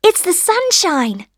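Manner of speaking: high, bright-spirited little sunshine; cheery little voice. A narrator's reading. Sunshine answering the rosebud, cheery. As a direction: pipe up cheerily, pitched high. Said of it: "said a little soft, cheery voice".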